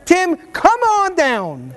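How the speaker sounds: host calling a volunteer from the crowd, slow and drawn out; game show call. Real, not acted.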